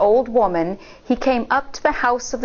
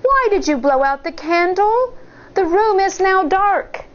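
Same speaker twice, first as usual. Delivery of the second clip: put-on high worried little girl; high child voice; child's voice; 10 semitones higher than usual. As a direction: ask like an uneasy child. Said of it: "Shang asked".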